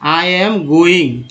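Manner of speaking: I am going spoken very slowly, word by word; teacher's slow model sentence; slow demo phrase. Shown as a demonstration. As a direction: drag out I am going for learners. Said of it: "जैसे मैं बोल देता हूँ कि".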